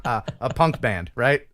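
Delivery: ordinary talk, nothing marked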